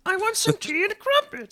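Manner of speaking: high, slow, squeaky bad English accent; hammed-up child's English accent, very high. Host doing a terrible English accent for laughs. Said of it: "such a bad English accent".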